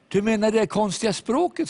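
girl asking about tongues, lightly voiced; teen girl. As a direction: voice the curious teenage girl.

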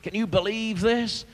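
high, quick, driving sermon delivery; urgent, ringing pulpit rhetoric; impassioned preaching. Real, not acted. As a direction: hammer out the repeated phrases to the congregation.

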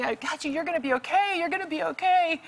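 high-pitched calling reassurance; urgent reassurance. Retold or imitated. Rescuer to the man in the net, high urgent reassurance. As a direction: call it out high and insistent.

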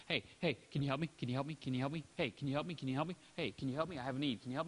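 a low nagging beg, over and over; pestering pleas. Retold or imitated. Pestering Sister Francis for help, low and nagging.

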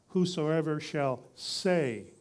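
slowly, with "say" held out for emphasis; stress on SAY. Real, not acted. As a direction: drive home "say" with a long, firm stress. Preacher repeats key word.

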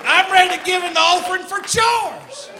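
high, loud revival holler; hammed-up church fervor; worked-up shout. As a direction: shout it like a stirred congregation. Carried away by preacher bit.

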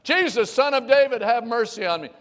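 high, loud, pleading call over a crowd; desperate cry. Acted. Blind beggar calling out to Jesus, loud and pleading. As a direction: cry out for help from the roadside. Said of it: "they hear this guy cry out".

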